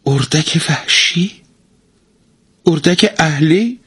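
puzzled, voice up high; surprised. Acted. Husband bewildered by wife's anger, high astonished voice. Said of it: "با تعجب پرسید".

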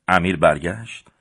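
ordinary delivery

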